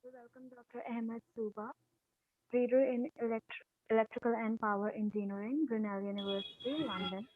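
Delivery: faint and distant, barely audible; speaking far too quietly; very low voice. Real, not acted. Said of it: "very low".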